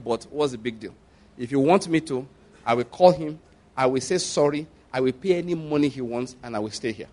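low, soft, unadorned plea; simple prayer. Retold or imitated. Husband offering to apologise in prayer, simply and softly. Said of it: "That's how I prayed that prayer that day, simply".

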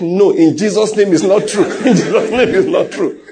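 hammed-up rejection in Jesus' name; comically insistent, half-laughing protest; mock prayerful denial. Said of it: "you're like".

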